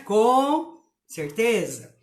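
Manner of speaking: Com certeza slow, with a clear break between com and certeza; spaced apart. Shown as a demonstration. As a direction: pull the two words apart. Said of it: "Palavra com certeza, separada". (separar bem com e certeza)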